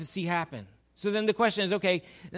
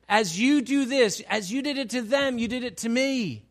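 higher and louder, driving sermon cadence; fervent preacher pressing each example; preaching at full force; 6 semitones higher, 6 dB louder than usual. Acted. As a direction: drive the point home to the congregation.

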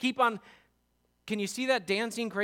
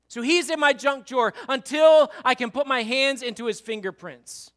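higher, louder, aggrieved protest; hurt complaint; 7 semitones higher, 6 dB louder than usual. Retold or imitated. A hurt believer blaming Jesus, bitter. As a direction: pour out the hurt.

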